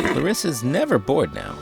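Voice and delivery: ordinary delivery, nothing marked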